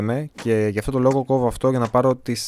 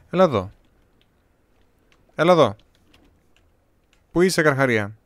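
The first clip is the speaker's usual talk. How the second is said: baiting the shark loudly; calling out; 3 semitones higher, 6 dB louder than usual. Real, not acted. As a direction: yell for the shark to come.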